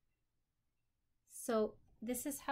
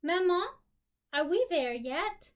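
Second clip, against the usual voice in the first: put-on child calling out; 8 semitones higher, 11 dB louder than usual. Child calling to her mother.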